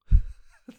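sudden loud breathy laugh; loud breathy laugh burst. Real, not acted. Comedian laughing at the postal clerks, loud and breathy. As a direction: burst out laughing at his own point.

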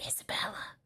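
hushed whisper; whispered prompt. Real, not acted. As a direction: whisper the name as a cue. Prompts partner's missed line.

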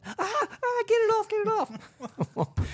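high, panicked shriek; phobic panic. Retold or imitated. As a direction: shriek about a bug on her head. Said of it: "Karen just starts going".

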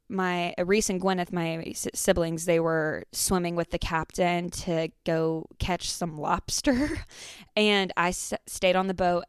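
ordinary delivery

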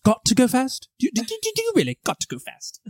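Hugh Grant impression. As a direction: fuss politely over going fast.

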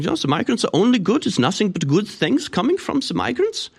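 high-pitched mock German accent. German person defending migrants to a sceptical visitor, German accent. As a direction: gush in a squeaky German accent. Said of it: "talking to a German person".

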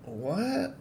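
high, soft, rising disbelief; small incredulous squeak of a question; baffled what. Retold or imitated. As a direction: imitate a bewildered reaction. Boys' baffled reaction to threats.

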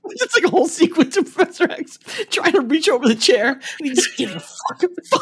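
high voice breaking up with laughter; real giggly, high-pitched telling; laughing. Real, not acted. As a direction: tell it while losing it laughing. Cracking up describing the chair gag.

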